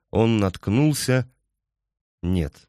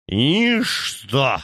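put-on slurred drunk; 5 semitones higher than usual. Drunk man flares up.

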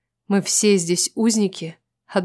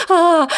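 sharp high frightened cry; startled scream; 9 semitones higher, 5 dB louder than usual. Acted. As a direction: shriek at the sudden dog. Startled by dog.

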